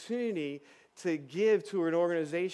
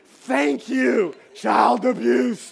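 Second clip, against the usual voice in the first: loud, slow mock shout of thanks; voicing the relieved kid; 5 semitones higher, 10 dB louder, much slower than usual. Boy thanking the speaker for sparing him the dirt drink, mock shout. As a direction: ham up the boy's relief, drawn out and shouted. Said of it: "He's like".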